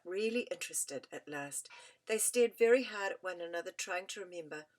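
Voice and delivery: plain reading voice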